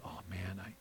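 ordinary delivery

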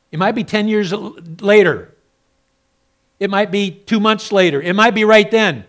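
loud, high, fiery pulpit preaching; full-force preaching. Acted. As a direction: preach it out hard to the congregation.

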